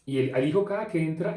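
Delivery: usual speaking voice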